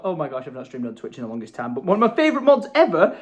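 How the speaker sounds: usual speaking voice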